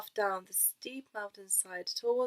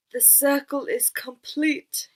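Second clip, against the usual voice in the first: slow, louder solemn wise man; solemn announcement; 5 semitones higher, 7 dB louder, slower than usual. Acted. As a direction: proclaim it slowly and gravely. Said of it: "he announced solemnly".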